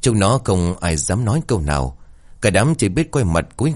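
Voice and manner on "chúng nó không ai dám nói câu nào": ordinary delivery, nothing marked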